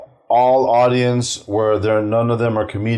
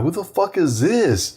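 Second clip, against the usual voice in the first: high, drawn-out disbelief; slow, incredulous mock astonishment; retold total shock; 8 semitones higher, slower than usual. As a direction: act out being stunned, pitch leaping up. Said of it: "I was totally shocked".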